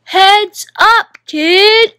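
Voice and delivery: loud, high call from a ball player; warning shout. Acted. Ball player warning the boy, loud shout. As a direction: holler across the beach.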